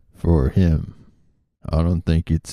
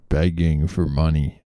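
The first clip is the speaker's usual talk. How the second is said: normal talking voice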